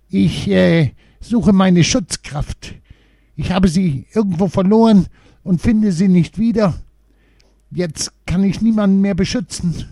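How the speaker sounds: high, hesitant little dwarf explaining his loss; a small lost dwarf, unsure and searching for words. A narrator's reading. Little dwarf explaining to the children, high and hesitant.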